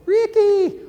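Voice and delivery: calling out. Real, not acted. Calling out to Ricky Gervais.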